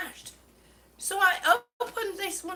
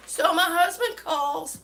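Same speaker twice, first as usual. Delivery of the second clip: ordinary talk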